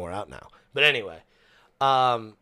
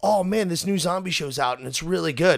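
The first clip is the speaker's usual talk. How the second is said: mock excited fan; 8 semitones higher, 5 dB louder than usual. People hyping zombie show.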